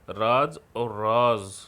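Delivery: राज first with j, then राज with a z sound, slow; showing how the nukta changes the sound; raj vs raaz contrast. Shown as a demonstration. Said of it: "नुक्ता लगाने से उनका अर्थ बदल जाता".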